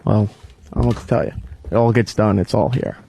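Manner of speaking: ordinary delivery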